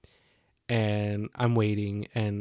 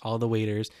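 ordinary delivery

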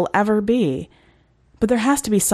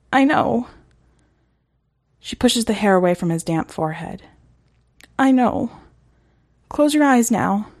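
higher, tender pleading; reassuring promise; 4 semitones higher than usual. Acted. Reagan soothing Eric before the end, tender and higher.

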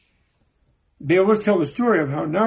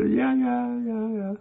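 high, trailing, unconvincing assent; hesitant yes; 7 semitones higher, 5 dB quieter, slower than usual. Retold or imitated. Professor hesitates before claiming he understands, high hesitant voice. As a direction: imitate a hedging yes, pitched up and fading. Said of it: "he hesitated a minute".

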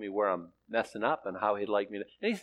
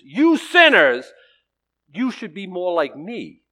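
loud, drawn-out denunciation, imitating an angry preacher; hammed-up fire-and-brimstone scolding; mock raging preacher; 5 semitones higher, 10 dB louder, slower than usual. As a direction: start high and very loud, slowly condemning. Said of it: "red-faced with rage and just".

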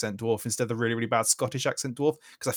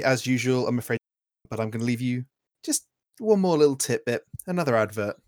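ordinary talk

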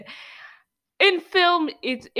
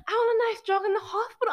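high sing-song mock nurse; 11 semitones higher, faster than usual. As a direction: lampoon a lazy nurse up high. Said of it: "It's like".

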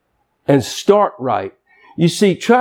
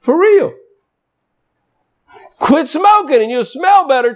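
full-force preaching; 9 semitones higher than usual. Acted. Preacher urging church to stop wasting money, fervent. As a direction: preach it out to the congregation.

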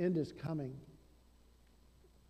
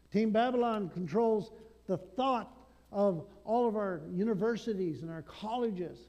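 louder, higher building sermon cry; preaching at full force; 4 semitones higher, 5 dB louder than usual. Acted. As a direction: hammer each line at the congregation.

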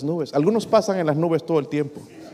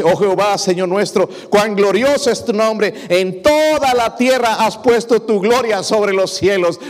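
higher, slow, lifted praise reading; exalted psalm reading; 7 semitones higher, 4 dB louder, slower than usual. Acted. Pastor reading Psalm 8 to the congregation, exalted and slow. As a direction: lift the psalm up slowly like a hymn.

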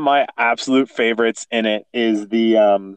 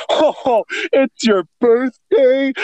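high, slow dawning surprise; surprised Kronk; 12 semitones higher, much slower than usual. Retold or imitated. Quoting Kronk realizing it's Yzma's birthday, high and slow. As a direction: let it dawn on you slowly, delighted.

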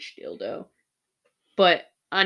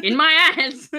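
louder exclamation, as if obvious; obvious shout; 5 semitones higher, 11 dB louder than usual. Retold or imitated. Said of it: "you made it sound like it should have been obvious".

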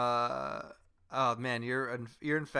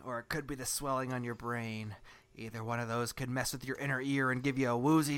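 ordinary talk

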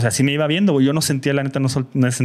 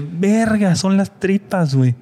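high-pitched acted alarm; retold horrified discovery, voice raised; shocked realization; 7 semitones higher, slower than usual. Injured rider realizing his guts are out, shocked. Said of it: "Y dije".

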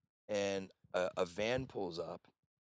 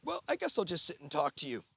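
higher, louder mock Australian woman; lightly put-on Australian lilt, pitched up; imitated Australian accent; 9 semitones higher, 6 dB louder than usual. Retelling a stranger joining them at the chip wagon, imitated Australian accent. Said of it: "with this Australian accent".

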